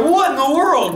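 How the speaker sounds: startled. Retold or imitated. Startled by the house shaking.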